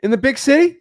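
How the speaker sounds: high, loud disbelieving question; can't believe the number, pitch it way up; incredulous surprise. Retold or imitated. Retelling his shock at an Italian salary, incredulous high question.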